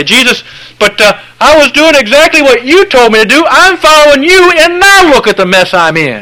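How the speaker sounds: high, loud whining complaint to Jesus; whiny complaining believer. Acted. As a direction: whine at Jesus like a hurt child.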